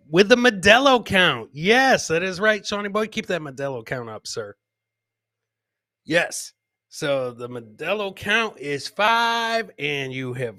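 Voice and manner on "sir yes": normal talking voice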